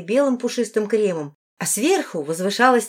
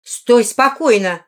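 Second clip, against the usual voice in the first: loud, slow, higher command; stern order; 4 semitones higher, 8 dB louder, slower than usual. A narrator's reading. A woman combing a squirming girl's hair, ordering sharply. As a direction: order it with impatient force.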